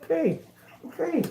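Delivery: high cooing okay; calm the guinea pig in a high voice; pet talk. Real, not acted. Soothing the pet.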